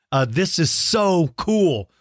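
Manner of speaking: normal talking voice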